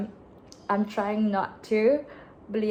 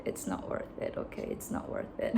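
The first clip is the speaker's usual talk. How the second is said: soft whisper to yourself; whispered self-talk; 7 dB quieter than usual. Shown as a demonstration. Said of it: "I have to say it to myself".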